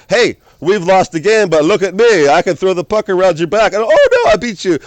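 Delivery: loud, high-pitched acted gloating; cocky mock boasting, leaping very high on "oh, no"; showboat taunt. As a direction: taunt the other team like a showboating star. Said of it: "Gino started to turn showboat mode on".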